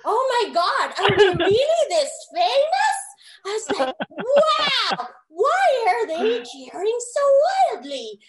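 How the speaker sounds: high, loud, giggly disbelief; starstruck amazement. Retold or imitated. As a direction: gush in amazement at a wildly cheering crowd. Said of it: "That was my reaction".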